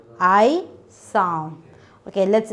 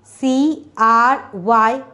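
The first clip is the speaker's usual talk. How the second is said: spelled out slowly; much slower than usual. Shown as a demonstration.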